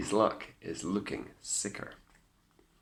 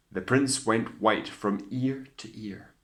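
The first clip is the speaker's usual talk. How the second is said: ordinary delivery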